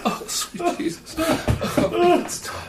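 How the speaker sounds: high, drawn-out laughing plea; real squeaky laughing fit; helpless laughing. Real, not acted. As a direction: beg for mercy through laughter. Still unable to stop laughing.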